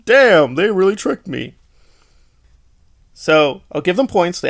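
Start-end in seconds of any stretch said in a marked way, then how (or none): 0.0-1.6 high, loud mock dismay; hammed-up villain reacting to a firework hit. Reviewer mocking the villain hit by a firework, higher and louder. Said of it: "he'll be like".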